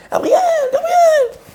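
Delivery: appel aigu et traînant, pour être entendu de loin. Retold or imitated. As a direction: crier le prénom de loin, en étirant les voyelles. Un ami l'appelle de loin. (un ami l'appelle sur la place, appel aigu et étiré)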